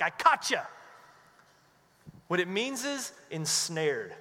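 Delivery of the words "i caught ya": gotcha taunt. Retold or imitated. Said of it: "it's not caught like".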